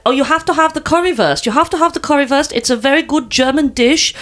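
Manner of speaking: put-on German accent. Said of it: "I'm gonna do the accent".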